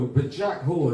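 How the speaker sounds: normal talking voice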